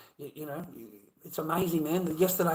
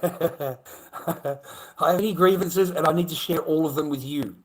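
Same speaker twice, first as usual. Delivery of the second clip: loud mock-indignant rant, pitched higher; hammed-up whinge; 4 semitones higher, 6 dB louder than usual. Streamer ranting at viewers in mock indignation. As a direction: play up the grumpy Aussie whinger. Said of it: "I like to have a whinge".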